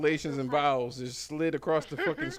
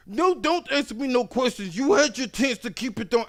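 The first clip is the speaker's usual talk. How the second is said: higher, louder, curt refusal; higher angry refusal; angry customer; 11 semitones higher, 8 dB louder than usual. Retold or imitated. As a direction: snap it at the agent.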